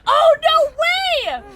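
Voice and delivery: high, drawn-out squeal of disbelief; shocked squeal. Real, not acted. Surprised reaction to perk news.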